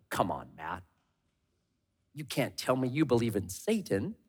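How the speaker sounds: low, quiet scoffing skeptic. Retold or imitated. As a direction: brush off the idea of Satan. Skeptic voiced mocking preacher.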